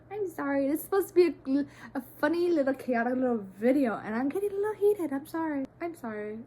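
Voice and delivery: high, flustered apology; a little heated. Real, not acted. Vlogger apologizing for ranting in a funny video, flustered and heated. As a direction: rush it out, high and worked up.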